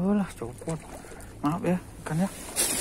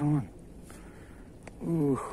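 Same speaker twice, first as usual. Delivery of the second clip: ordinary talk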